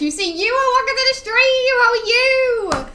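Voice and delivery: high, loud cartoon welcome; a loud, high-pitched goofy greeting to viewers; squeaky silly voice.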